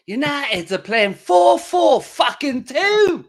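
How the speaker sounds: hammed-up football fan's rant, high and loud; slow, drawn-out shout in a muddled accent; Andy Tate. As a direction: bellow it high, accent wandering towards Caribbean. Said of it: "Andy Tate's sitting somewhere now going".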